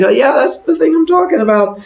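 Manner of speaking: high, loud put-on woman's voice; knowing reaction. Woman answering the growling box, high loud reaction. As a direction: shoot the pitch up and exclaim.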